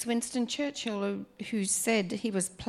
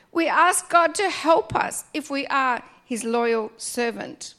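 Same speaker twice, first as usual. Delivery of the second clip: ordinary talk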